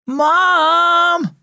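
high, drawn-out yell; yelling for Mom. A narrator's reading. Girl calls her mother over laughing brothers, long high yell. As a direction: holler it like a fed-up kid. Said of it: "Bree yelled over the boys laughing".

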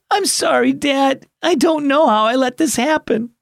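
put-on high girl voice, a touch of sobbing; sobbing apology. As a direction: say sorry through mild tears. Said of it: "Kaya sobbed".

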